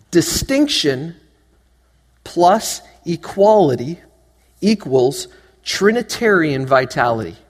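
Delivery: slow, word by word like a formula. Shown as a demonstration. Preacher teaching a formula, slow and deliberate. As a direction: spell out Distinction plus equality slowly. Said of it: "the math part of the sermon".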